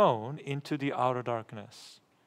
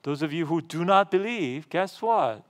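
loud, raised voice quoting a warning; preacher voicing Jesus' startling warning, louder and higher; shocking pronouncement; 4 semitones higher, 7 dB louder than usual. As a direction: deliver the quote as a forceful challenge.

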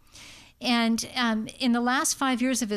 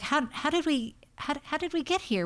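stammered, higher-pitched mild bewilderment; bewildered; 5 semitones higher than usual. Acted. Family to their doctor, how did we get here, mildly bewildered. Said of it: "helps calm this bewilderment".

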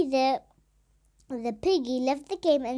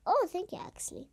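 ordinary talk, nothing marked

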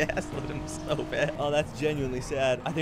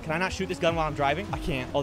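ordinary talk

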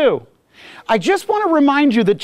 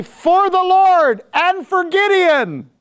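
higher, slow ringing battle cry, each word stretched out; 10 semitones higher, much slower than usual. Retold or imitated. Preacher reading Gideon's battle cry aloud, drawn-out and rousing. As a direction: proclaim it like a rallying shout.

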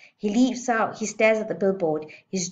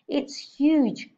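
put-on higher awed girl; amazed; 8 semitones higher, 4 dB louder than usual. A narrator's reading. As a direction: wonder at it. Girl marvelling.

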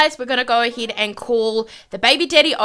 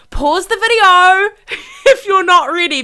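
loud, higher, breaking into laughter; giggly warning; 7 semitones higher, 7 dB louder than usual. Real, not acted. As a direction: laugh while teasing a big reveal. Giggling warning before a reveal.

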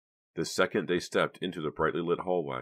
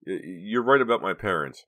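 nervous stammer, louder, tripping on the first word; 4 semitones higher, 9 dB louder than usual. A narrator's reading. Boy caught out, stammering to his girlfriend. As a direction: blurt it out nervously, stumbling at the start. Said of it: "Vincent stammered".

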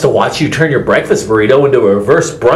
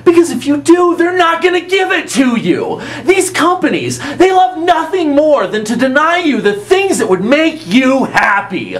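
excited rant; 11 semitones higher than usual. Acted. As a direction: rant it out, worked up. Ranting advice, shouting laughs.

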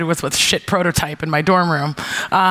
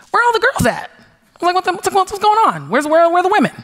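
much higher, alarmed disbelief; shocked question; 10 semitones higher, faster than usual. Retold or imitated. In the camp asking staff, a shocked high question. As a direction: ask in startled alarm, pitched way up.

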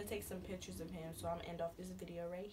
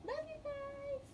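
high sign-off; slower than usual. Real, not acted. Repeat sign-off.

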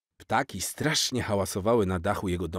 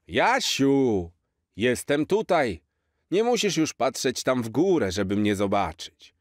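lightly voiced jolly Santa, higher; a cheerful old Santa, slightly put-on; hint of Santa; 5 semitones higher, 3 dB louder than usual. Santa to Jaś on the roof, lightly voiced jolly.

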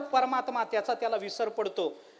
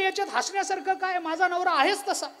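high indignant wife's voice; lightly voiced proud wife retorting; 7 semitones higher, 3 dB louder than usual. Proud wife defends husband to saint.

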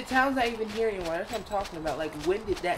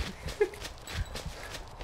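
breathy laugh; 12 semitones higher, 10 dB quieter than usual. Real, not acted. Laughs at character running.

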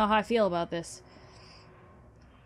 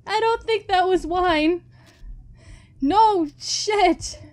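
shaken; 9 semitones higher, 5 dB louder, slower than usual. Real, not acted. Shaken after ending.

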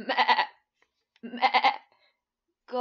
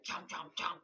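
low, breathy chewing noise; chomping sound; 6 semitones lower than usual. Shown as a demonstration. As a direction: chew it out like a horse. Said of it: "Make your chomping sound".

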